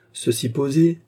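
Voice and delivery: plain reading voice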